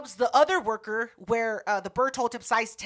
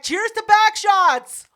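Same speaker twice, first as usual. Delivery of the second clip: loud, high, drawn-out mock toast; slow, raised-voice party cheer; rowdy toast; 8 semitones higher, 9 dB louder, slower than usual. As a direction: call out a teasing toast to the room. Group toast retold.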